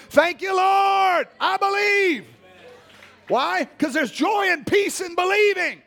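preacher crying out the faith declaration; preaching at full force. Acted. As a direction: declare it, swelling to a shout. Confession builds to shouting.

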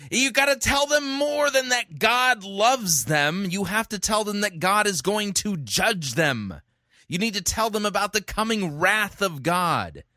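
booming preacher voice. Retold or imitated. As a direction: imitate an old preacher thundering about judgment. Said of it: "his big booming voice going".

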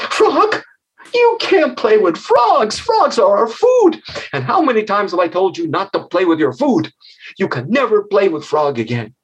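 very high scolding, easing down to a firm rule; horrified mother snake. Acted.

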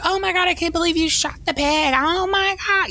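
high, squealing mock disbelief; mock shock. Retelling a movie scene to a co-host, high mock shock. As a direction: gasp it out way up high like a shocked bystander. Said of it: "So they're all like".

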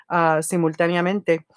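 ordinary delivery

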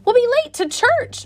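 fretting mother. A narrator's reading. Mother rushing the family.

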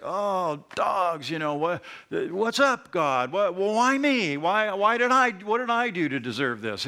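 high, pleading why me; mock a self-pitying complainer; whining believer. Preacher mocking a grumbling believer, high and whiny. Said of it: "it's like".

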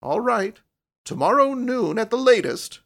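high, loud landlady ultimatum; grudging landlady. A narrator's reading. Landlady to June before clumping downstairs, grudging and insistent. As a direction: lay down the deadline, high and loud. Landlady figure setting deadline.

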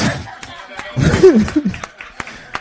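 high, breathy burst of laughter; big laugh. Real, not acted. Laughs at own joke.

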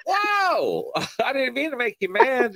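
high-pitched retold alarm; startled backing off. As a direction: back off in mock shock, voice shooting way up.

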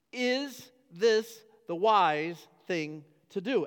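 slow, spaced-out question with weight on "wise"; stress on "wise". Shown as a demonstration. Preacher teaching the question to memorize, slow stress on "wise". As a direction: land hard on "wise", word by word. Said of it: "Emphasis on the word".

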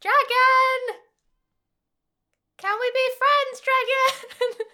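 high, loud hopeful call. Acted. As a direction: shout up to wake a dragon. Character calls to sleeping dragon.

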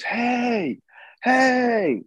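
high urgent hey, hey; a neighbor's high call. Retold or imitated. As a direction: call down the hall to get his attention. Neighbor calling out from hallway.

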